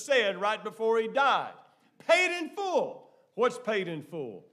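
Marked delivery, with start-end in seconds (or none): 2.0-3.1 high, drawn-out proclamation; triumphant cry. Acted. Preacher voicing what Jesus said before he died, high cry. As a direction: call it out high, stretching the words. Cries out Jesus' last words.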